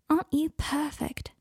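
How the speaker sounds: slow, high soft coo. Acted. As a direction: coo it like to a puppy. Adelaide cooing at wolf.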